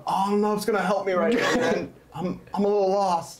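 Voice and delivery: hint of a clueless guy; lost dude. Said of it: "he goes".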